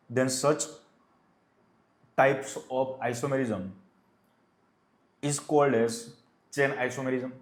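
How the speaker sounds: very slow, word by word, pausing between phrases for students to write; slow dictation. Shown as a demonstration. Teacher to class copying the definition, slow dictation. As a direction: dictate the definition at writing speed. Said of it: "definition लिख लो".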